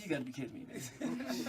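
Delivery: a low, hushed disbelieving aside under his breath; stunned aside. Real, not acted. Seeing a huge bull walk out of the house, hushed disbelief.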